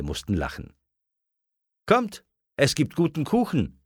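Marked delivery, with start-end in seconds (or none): 1.9-3.8 high-pitched call to cake; inviting. A narrator's reading. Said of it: "lud die Mutter ein". (einladend und hell gerufen)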